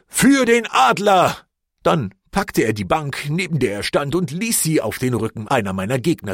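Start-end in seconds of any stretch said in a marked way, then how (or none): 0.1-1.4 put-on slow, high, loud echoing yell from an old man; shouted battle cry. A narrator's reading. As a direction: bellow it back like a grey-haired veteran joining in.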